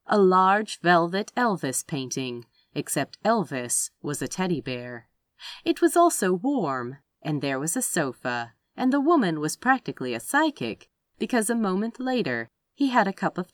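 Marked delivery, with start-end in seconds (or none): none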